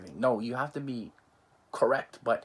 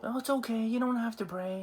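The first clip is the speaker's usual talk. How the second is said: mock breezy; 11 semitones higher than usual. Mocking overly lax people.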